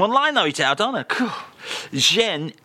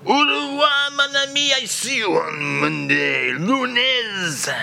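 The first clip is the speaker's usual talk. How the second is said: mock French farewell; 9 semitones higher, slower than usual. [name] bidding the host farewell, hammed-up French. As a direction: sing out the au revoir grandly.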